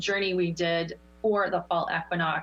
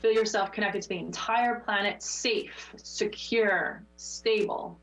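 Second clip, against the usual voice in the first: guided meditation. Acted. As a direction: ease them back gently. Leads meditation close.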